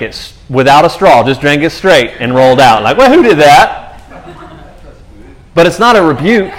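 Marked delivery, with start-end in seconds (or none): 3.0-4.0 high, loud, slow startled question; startled Jesus. Retold or imitated. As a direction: stop short and ask in surprise. Jesus notices the touch.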